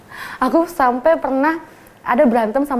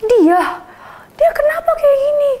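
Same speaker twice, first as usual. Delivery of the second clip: high shouted disbelief; shocked outrage; 4 dB louder than usual. Retold or imitated. Student learning the teacher soaked her exam, shocked shout. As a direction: blurt it out, outraged.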